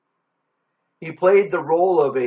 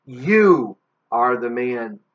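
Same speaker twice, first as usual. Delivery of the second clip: very slow, weighty accusation; accusatory declaration; 3 semitones lower, 4 dB louder, much slower than usual. Acted. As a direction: drag it out to condemn, leaning on "You". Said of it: "raised an accusatory finger".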